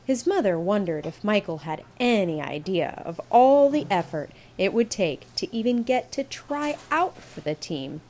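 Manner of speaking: ordinary delivery, nothing marked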